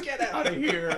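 high-pitched mock delight through laughter; laughing disbelief. Newlyweds amazed by the tickets, laughing disbelief. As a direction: crack up imitating a thrilled reaction.

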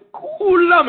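כולם stretched long and pitched high; stress on כולם. Real, not acted. Preacher assures listeners everyone gets a note, כולם high and drawn out.

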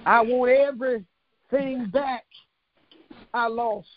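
drawn out and stressed again; slow it down and lean on it; slow emphatic repeat. Real, not acted. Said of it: "repeats it".

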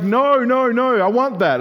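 put-on high, loud toddler tantrum; whiny toddler. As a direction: refuse like a two-year-old grabbing for the knife. Toddler voiced by father.

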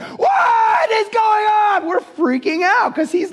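very high, drawn-out imitation of panicked kids; hammed-up crowd hysteria, stretched and squealed; mock freak-out. Preacher voicing youth group kids witnessing a healing, mock freak-out.

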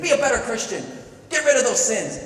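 loud, high mock scolding; demanding exhortation. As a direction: order someone to shape up, acted. Voicing demanding people.

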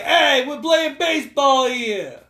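slow, stretched New Yorker calling out; hammed-up New York heckle; New York accent. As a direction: call it out like a cartoon New Yorker. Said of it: "talking with a New York accent".

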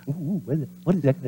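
hushed eager whisper; curious kid. Retold or imitated. Kid eagerly asking about the secret, hushed. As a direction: lean in, eager to hear.

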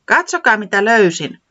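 excited mushroom picker; eager showing. Acted. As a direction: show off the find. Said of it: "se jatkoi".

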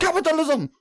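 put-on very high, off-key politician accent; hammed-up campaign rant sounding like Count Dracula; Bernie Sanders impression. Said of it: "he's trying to do a Bernie accent".

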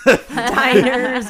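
slow, loud words through laughter; laughing. Real, not acted. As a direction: laugh out the line. Laughing while listing.

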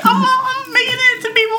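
squeaky high-pitched delight; bubbly high mock thrill; high excited voice. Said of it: "So I'm like".

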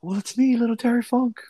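high, put-on little cowboy voice; Terry Funk. Podcaster mimicking a supposedly soft-spoken wrestler, high put-on voice. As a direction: pitch it way up, wrestler impression. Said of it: "that soft-spoken".

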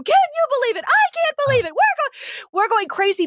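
high, indignant exclaiming; outraged disbelief. Real, not acted. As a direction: voice shooting up in disbelief. Ranting about adult-only nugget toys.

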